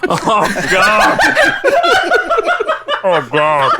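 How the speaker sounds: loud laughing exclamation. Real, not acted. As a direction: burst out laughing. Player laughs at joke.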